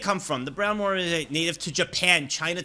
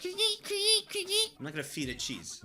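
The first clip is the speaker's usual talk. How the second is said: odd voice; 5 dB quieter, much slower than usual. Acted.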